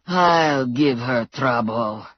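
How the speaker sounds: slow, low grunted threat; grunting thug. A narrator's reading. Blutovich threatening the heroine, slow and grunted. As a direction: growl it out slowly. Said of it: "Blutovich grunted".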